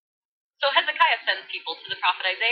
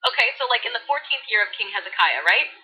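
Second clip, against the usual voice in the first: put-on bubbly valley girl voice. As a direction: read scripture like a valley girl. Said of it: "Valley Girl edition".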